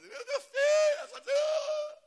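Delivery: high, loud, broken cry; father sobbing over his son, high and loud; weeping father. Retold or imitated. Father weeping at son's return.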